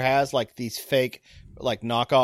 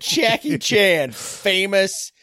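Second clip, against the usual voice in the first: slow, higher-pitched mock announcement; playful echo; 6 semitones higher, 3 dB louder, much slower than usual. Co-host repeating the suggested famous name, playful drawl. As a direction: draw the name out, high and slow.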